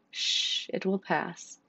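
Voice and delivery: hushed shushing, soft and calm; calm her down gently; soothing. Acted. Mother soothing a child in pain.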